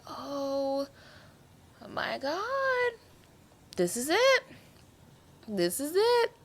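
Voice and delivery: high, hushed disbelief; watching the death coming; stunned dread. Retold or imitated. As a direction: gasp at what's coming. Retold shock at the death.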